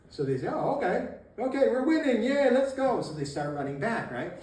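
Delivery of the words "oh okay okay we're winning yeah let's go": high, building to a loud cheer; mock rallying excitement. His guys decide they are winning, acted excited cheer. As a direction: rouse yourself from acceptance to eager shout.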